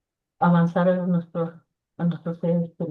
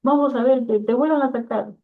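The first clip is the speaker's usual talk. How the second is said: louder, higher brisk command; brother's insistent order; 6 semitones higher, 4 dB louder than usual. Retold or imitated. Doctor brother insisting on a new scan, louder and higher. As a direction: push her to get the scan redone.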